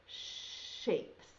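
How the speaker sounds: "shapes" breathed out, stretching the sh; whispered sh sound. Shown as a demonstration. Teacher whispering the word so kids hear the first sound. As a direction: hiss the sh of "shapes" softly. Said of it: "Does it start with S H".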